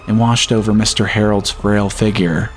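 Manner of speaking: ordinary delivery, nothing marked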